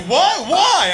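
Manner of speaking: high, loud startled questions; alarmed brother. Retold or imitated. Brother told to leave, high startled yelp. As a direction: yelp in confusion.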